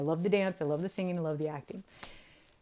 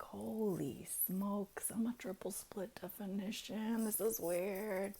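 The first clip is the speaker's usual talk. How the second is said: hushed awe; 10 dB quieter than usual. Retold or imitated. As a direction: breathe it out in amazed disbelief. Retelling her stunned private reaction.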